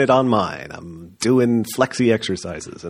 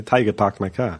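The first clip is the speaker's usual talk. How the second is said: put-on Boston accent, "Taiga" said like tiger and "parked my car" with no r; New England accent. A playful Boston-style drop of the r sounds. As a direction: do a joking New England "pahk my cah". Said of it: "sounds like tiger to somebody from New England".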